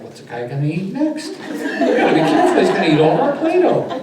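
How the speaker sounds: high, loud, laughing mock alarm; imitated worried kids. Children wary of Play-Doh eater, mock alarm. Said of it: "they kept looking at me like".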